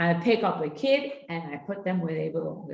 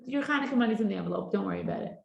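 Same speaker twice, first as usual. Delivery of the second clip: breezy calm mom; 8 semitones higher than usual. Shown as a demonstration. Mother calmly telling child about the cab, light and high. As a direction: shrug it off kindly. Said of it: "they're like".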